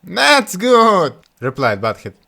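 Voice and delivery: high, loud cheeky fool; bold Butthead. Acted. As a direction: stride in brashly. Butthead answers.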